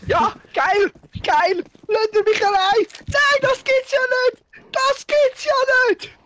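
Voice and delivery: high-pitched frantic yelling; excited shrieking. Real, not acted. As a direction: shriek it in a panic. Frantic gaming moment, high shouting.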